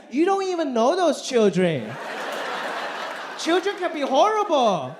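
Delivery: high, sharp mom voice; a mother catching him out; suspicious mom. Retold or imitated. Voicing his mom.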